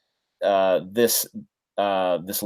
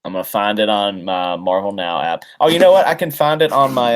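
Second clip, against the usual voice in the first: ordinary talk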